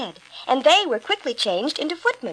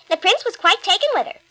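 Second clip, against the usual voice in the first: gossiping stepsister; 6 semitones higher, 3 dB louder than usual. A narrator's reading. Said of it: "They could talk of nothing but".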